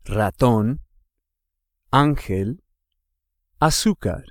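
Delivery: "Ratón" on TÓN, "ángel" on ÁN, "azúcar" on ZÚ; "Ratón", "ángel", "azúcar" as separate examples, each accented vowel stressed; stress on accented syllable. Shown as a demonstration. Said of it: "you emphasize that syllable".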